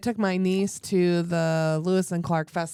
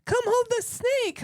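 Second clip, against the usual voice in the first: eager niece. Retold or imitated. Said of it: "And she's like".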